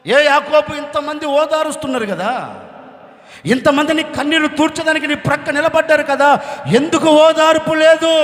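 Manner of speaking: loud, insistent questioning; preacher's forceful rhetorical appeal; acted remonstrance. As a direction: challenge a mourner, building to a loud demand. Preacher challenges Jacob directly.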